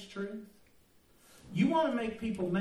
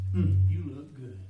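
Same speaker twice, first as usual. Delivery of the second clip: devil's voice; 4 semitones lower, 6 dB louder than usual. Retold or imitated. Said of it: "the devil appeared and said".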